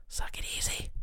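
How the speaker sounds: unvoiced whispered jab; sneaky whispered add-on; whispered aside. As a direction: breathe it out as a sly whisper. Whispered aside to inner critic.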